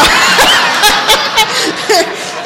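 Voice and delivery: high breathless laugh; helpless laughter at the memory. Real, not acted.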